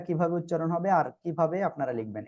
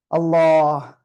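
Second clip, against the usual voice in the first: 1 prayerful call; 9 dB louder, much slower than usual. Real, not acted. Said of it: "opening a prayer after teaching".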